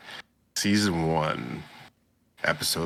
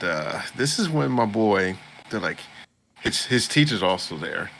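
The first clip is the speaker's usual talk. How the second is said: usual speaking voice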